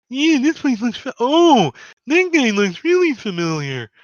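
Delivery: very high, loud little creature; Lulu character voice. Acted. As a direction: pipe up loudly as tiny Lulu. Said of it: "Lulu's like".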